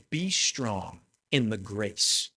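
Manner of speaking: soft and slow, as Paul writing tenderly to Timothy; gentle Paul quote. Retold or imitated. Preacher voicing Paul's letter to Timothy, hushed and slow. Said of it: "do you hear that?".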